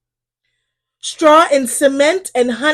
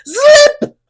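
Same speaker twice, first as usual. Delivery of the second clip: skidding sound; 13 semitones higher, 6 dB louder than usual. A narrator's reading. Engines skidding sound words.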